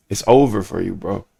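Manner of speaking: low, soft mock threat; deadpan mock warning. Streamer warning fans who greet him in public, deadpan mock threat.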